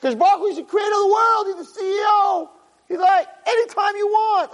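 shouted sermon climax, very high pitch; full-force preaching. Real, not acted. Rabbi proclaiming the Creator is always reachable, full-force preaching. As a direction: proclaim it at the top of your range.

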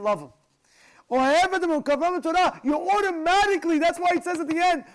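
high, loud, driving sermon voice; impassioned preaching. Real, not acted. Preacher teaches how to draw people close, fervently. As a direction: preach it at full force. Preaches the lesson at full force.

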